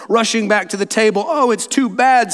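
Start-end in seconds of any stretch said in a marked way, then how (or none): 1.3-2.3 higher-pitched, lightly distressed lament; mild acted sob. Lucy at the empty stone table, mildly sobbing. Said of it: "sobbed Lucy".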